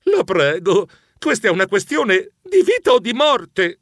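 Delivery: urgent pleading. Acted. Passenger begging the conductor for help, urgent pleading. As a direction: beg as if everything hangs on it. Begging her to restart train.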